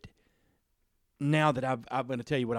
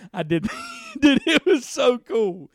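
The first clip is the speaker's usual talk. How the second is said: excited; 12 semitones higher, 9 dB louder, slower than usual. Real, not acted. Excited about [name] version.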